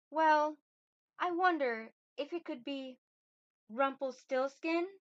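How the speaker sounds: put-on high, coy young lady's voice; young queen, feminine. Queen springs the true name on him, coy feminine voice. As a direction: stretch the final name into a rising question. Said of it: "soft-spoken and".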